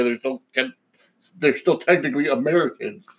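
normal talking voice

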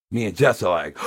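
ordinary delivery

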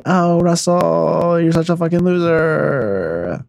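slow, drawn-out jeering; mock lament. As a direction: stretch every word into a drooping taunt. Mocking the man in the clip.

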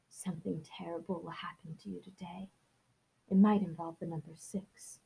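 hushed fortune-teller whisper; ominous whisper. Acted. Narrator voicing the psychic family's prediction, eerie whisper. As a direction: whisper the prophecy like a secret.

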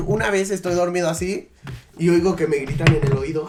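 usual speaking voice

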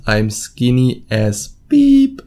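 normal talking voice

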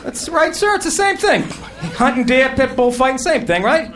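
high, mocking fake agreement; high sarcastic yes-man voice. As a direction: humor the fool with exaggerated agreement.

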